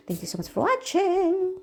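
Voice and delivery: high, slow sing-song thank you; drawn-out singing goodbye to viewers; sing-song outro. Real, not acted. (malambing na pakantang paalam)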